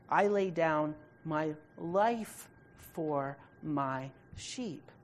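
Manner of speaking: very slow, word by word; measured, softening toward the end with pauses between phrases; slow and solemn. Acted. Preacher voicing the good shepherd's promise to his sheep, slow and solemn.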